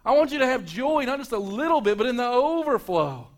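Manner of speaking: high, loud, soaring preacher's climax; preaching at full force. Acted. Preacher to his congregation, building to a loud, high climax. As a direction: lift the congregation, ringing out over them.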